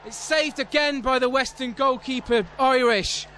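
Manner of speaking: high-pitched recap, easing off a little; excited commentary. Real, not acted. Commentator crediting the Western goalkeeper, excited. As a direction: stay high with excitement, naming the hero. Names keeper after second save.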